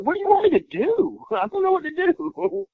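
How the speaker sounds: panicked helplessness. Retold or imitated. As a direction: squeak it out, helpless but amused. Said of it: "I was like".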